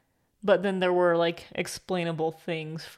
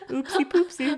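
playful Joey; 6 semitones higher than usual. Acted. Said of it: "I was being Joey".

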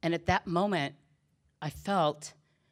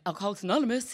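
mock-cheerful, higher and brighter pick-up; happy phone greeting; 5 semitones higher, 4 dB louder, much faster than usual. Cheery man answering the AA phone, chirpy imitation. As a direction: imitate an overjoyed hotline volunteer. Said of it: "He's, like, so happy".

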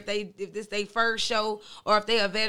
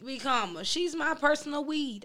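ordinary delivery

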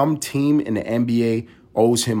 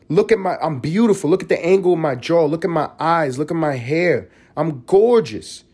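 higher, preening mock girl voice, mildly hammed; mock self-proud TikTok girl; 6 semitones higher than usual. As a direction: parody her showing off her looks. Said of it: "She was like".

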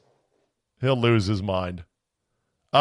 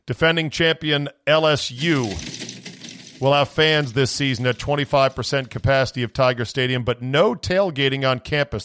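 ordinary delivery

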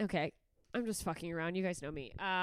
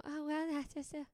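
higher, airy voice brushing it off; excusing dog owner; 8 semitones higher, 6 dB quieter than usual. Retold or imitated. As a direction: play the doting owner making excuses. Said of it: "And I just go".

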